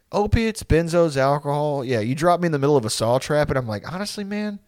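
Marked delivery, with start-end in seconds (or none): 3.9-4.6 softly spoken, easygoing acceptance; mellow unbothered. Acted. Stoned reaction to a saw trap.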